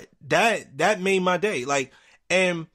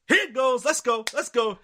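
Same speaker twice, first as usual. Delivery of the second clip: higher, eager mock cheering; hyped up; 8 semitones higher than usual. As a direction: egg it on like a fan ready for a fight. Narrator hyped as fight nears.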